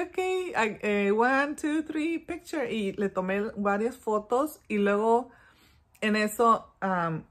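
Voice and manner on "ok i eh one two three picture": high sing-song countdown. Acted. Counting down photo for daughter.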